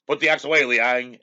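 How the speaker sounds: retold captain shouting a stop order; commanding shout. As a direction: bark the order across the square. Tracy calls out to stop execution.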